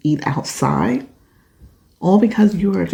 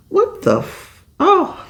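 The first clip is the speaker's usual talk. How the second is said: shocked outburst; 13 semitones higher, slower than usual. Real, not acted. Reacting to the letter, a stunned high-pitched outburst. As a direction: pitch leaps high in disbelief. Shocked reading grandmother's words.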